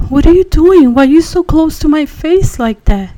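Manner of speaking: high, loud startled outburst; startled cry. Retold or imitated. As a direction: jolt awake and cry out at him. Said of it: "and I got startled".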